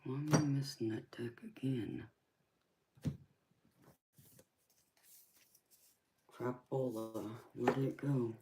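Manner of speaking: soft, half-whispered self-talk; muttering to oneself. Real, not acted. Streamer hunting for a missing deck, muttering to self. Muttering to herself while searching.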